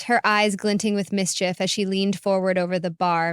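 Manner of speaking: ordinary delivery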